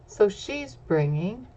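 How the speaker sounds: plain reading voice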